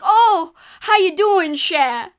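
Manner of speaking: loud, high-pitched heavy Cajun greeting; Cajun accent. Retold or imitated. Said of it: "a very heavy accent".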